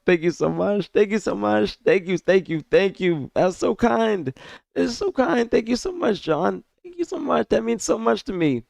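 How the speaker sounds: high, tearful gratitude; real choked-up high voice; happy crying. Real, not acted. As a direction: thank them while welling up. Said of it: "that's me really crying".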